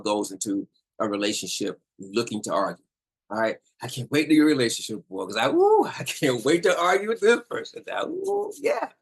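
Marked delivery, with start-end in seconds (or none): 3.8-8.9 hammed-up high, loud whooping; sarcastically thrilled, pitch shooting up; mock excitement. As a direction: gush with fake eagerness, woo-hooing. Acts a person eager to argue.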